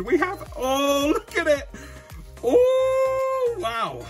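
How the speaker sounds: high thrilled oh wow; genuinely thrilled unboxing moment; excited gasp. Real, not acted. Opening first vintage pack.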